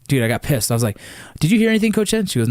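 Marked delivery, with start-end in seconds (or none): 1.4-2.3 higher, raised voice, exasperated at daughter; angry retort. Retold or imitated. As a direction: snap it at her like a fed-up dad. Heated argument with daughter after loss.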